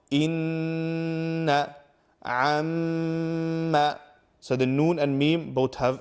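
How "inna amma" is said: inna, amma hummed through the nose with the nasal sound held; nasal gunna demo. Shown as a demonstration. Teacher shows viewers the nasal gunna, held and steady. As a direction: hold the nn and mm in the nose for a full beat. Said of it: "So the examples are".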